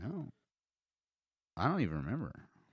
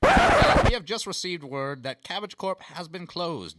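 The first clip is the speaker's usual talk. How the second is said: news bulletin; 8 semitones higher, 9 dB louder, slower than usual. Pretend.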